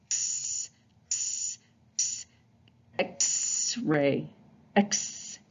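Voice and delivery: unvoiced ks, air forced out; breathy X sound. Shown as a demonstration. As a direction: push air through the teeth, ks. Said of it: "Kinda forcing air out".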